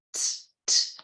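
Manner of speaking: gentle unvoiced tss, slow and faint; a slow hiss barely in the background; soft background tss. Shown as a demonstration. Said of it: "fazendo esse tss devagarinho, bem de fundinho". (chiado suave, devagarinho, de fundinho)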